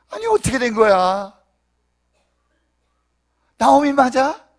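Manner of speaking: high, loud gossipy exclamations; loud, high-pitched disbelief; astonished townsfolk. Acted. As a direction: play a whole town buzzing in shock. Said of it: "온 성읍이 시끌벅적합니다".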